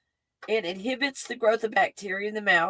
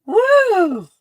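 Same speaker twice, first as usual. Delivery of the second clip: a sudden, high, loud whoop of energy; excited whoop; 11 semitones higher, 9 dB louder than usual. Real, not acted. Alone with her snack, whooping as the mint kicks in, excited. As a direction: burst out with a jolt of new energy.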